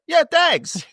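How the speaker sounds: high, far-off "Yeah, dags" as the mum; chirpy traveller mum's "dags"; mock Irish traveller mum. Mum answering Tommy about dogs, in a high mock Irish traveller voice. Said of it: "the mom looks at him and is like".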